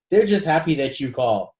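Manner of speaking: ordinary talk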